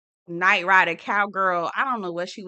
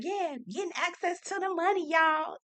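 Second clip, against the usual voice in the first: imitating; 8 semitones higher, 6 dB quieter than usual. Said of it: "Sweet Tea probably was like".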